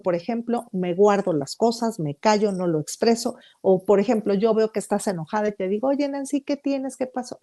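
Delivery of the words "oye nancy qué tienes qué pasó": higher, softer caring question; concerned friend asking. Retold or imitated. Friend gently asks an upset Nancy what's wrong, higher and softer. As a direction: gently ask what's wrong, voice raised in pitch. Acted example of passive communication.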